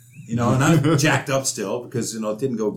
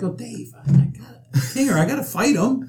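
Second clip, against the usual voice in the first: mock pleading; 12 semitones higher than usual. His own reply to coach retold.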